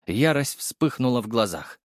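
plain reading voice

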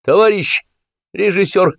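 high, eager call. A narrator's reading. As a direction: hail the director from the crowd. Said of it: "крикнул Стасик".

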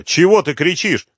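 loud, high irritated outburst; angry. A narrator's reading. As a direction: scold him angrily. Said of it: "разозлился Малявка".

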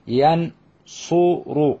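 ينصر split into slow yan-su-ru; teacher modelling ينصر, U in the middle; syllable by syllable. Shown as a demonstration. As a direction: sound out ينصر with pauses between syllables. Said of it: "مضارعه".

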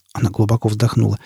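ordinary delivery, nothing marked

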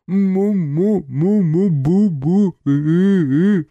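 slow loud muffled groan; gagged groaning. A narrator's reading. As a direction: squeeze it out gagged. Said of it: "выдавил тот".

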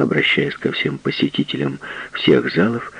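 plain reading voice

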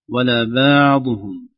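بعضهم very slow, ع drawn out; over-soft ain. Shown as a demonstration. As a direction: loosen the ع in بعضهم too much. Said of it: "لا رخاوة زائدة". (بعضهم بعين رخوة زائدة)

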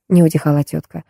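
plain reading voice

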